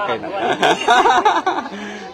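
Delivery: long high burst of laughter; high, drawn-out laughing fit. Real, not acted. Amused at the pupil's ending.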